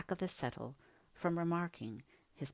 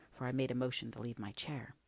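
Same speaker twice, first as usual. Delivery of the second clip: ordinary delivery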